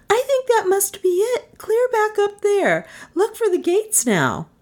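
very high, urgent woman's voice; excited Melissa. Acted. Passenger spotting the turnoff, high urgency. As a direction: direct the driver in a high, urgent pitch.

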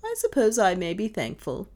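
ironic. A narrator's reading. Mistress needling her superstitious maid, ironic. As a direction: feign gratitude with irony. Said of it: "she went on ironically".

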